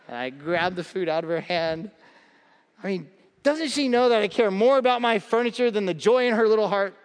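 normal talking voice

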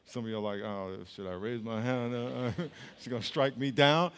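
very low, hushed hesitant mumble; timid congregant. Retold or imitated. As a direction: imitate a nervous churchgoer, soft and low. Timid congregant afraid to admit anger.

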